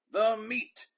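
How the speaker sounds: high, loud group roar; crew roar. A narrator's reading. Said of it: "we all roared".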